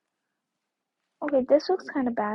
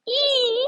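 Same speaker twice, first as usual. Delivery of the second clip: high, loud "eee" squeal; piercingly pitched squeal of mock delight; excited squeal; 11 semitones higher, 5 dB louder than usual. Squeal after friend's imagined delight.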